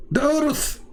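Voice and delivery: sudden high-pitched exclamation; mock alarm. Realising he forgot to draw the earth, mock alarm. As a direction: jump up high as if struck by a glaring omission.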